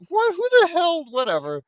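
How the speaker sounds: high, loud squeak of disbelief; shocked squeal. Real, not acted. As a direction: yelp in shock. Shrill reaction to odd name.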